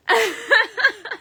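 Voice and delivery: a real, high burst of laughter; bright high giggle; high delighted laugh. Real, not acted. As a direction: laugh out loud at the cards. Laughs at the cards.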